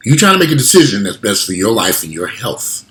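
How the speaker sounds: ordinary talk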